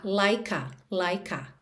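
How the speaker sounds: "like a" joined into "lai-ka"; the k of "like" carried onto "a"; linked like a. Shown as a demonstration. Teacher linking "like a" for students. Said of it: "naturalmente, a gente já liga".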